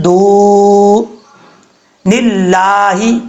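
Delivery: دو نلاہی with the waw held one count and a thin lam; slow recitation demo; slow tajweed demo. Shown as a demonstration. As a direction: draw out دو نلاہی slowly. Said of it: "ایک الف کی مقدار لمبا".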